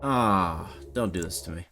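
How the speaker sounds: low, soft groan of dismay; hushed and low, letdown; deflated plea. Real, not acted. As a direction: sink into a quiet, defeated complaint. Deflated after losing.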